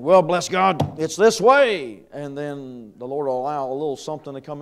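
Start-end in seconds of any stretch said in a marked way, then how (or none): 0.0-2.0 put-on loud, cocksure young zealot, drawing out the end; brash young believer. Preacher mimicking what you think when you're young, cocksure. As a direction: declare it with youthful certainty, higher and louder. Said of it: "when you're young, you think".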